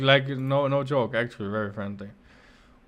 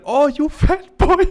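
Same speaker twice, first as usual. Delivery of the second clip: quoting the Japanese man; 12 semitones higher, 8 dB louder, slower than usual. Retold or imitated. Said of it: "patted my belly and said".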